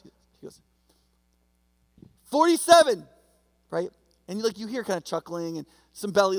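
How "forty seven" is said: shouted high and loud across the courtyard; loud mock call across the yard; yelled number. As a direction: call it out the window to the other cells. Prisoner yells joke number out window.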